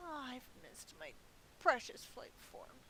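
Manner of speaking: normal talking voice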